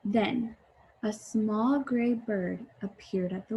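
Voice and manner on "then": ordinary delivery, nothing marked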